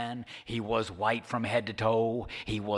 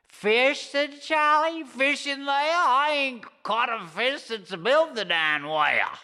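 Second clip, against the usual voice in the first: put-on much higher, loud, cranky old codger voice; high scratchy old fisherman, outraged; indignant Charlie; 5 dB louder than usual. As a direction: squawk back in disbelief. Charlie answers serpent incredulously.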